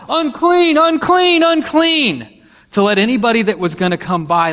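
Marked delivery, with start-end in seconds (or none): 0.1-2.3 high, loud cry of unclean; calling out unclean. Retold or imitated. Leper warning passersby, high loud cries. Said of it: "scream out".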